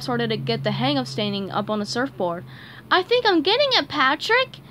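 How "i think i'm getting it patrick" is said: much higher, thrilled and proud; high gleeful kid voice; excited. Acted. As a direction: beam with new confidence. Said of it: "he said, excited".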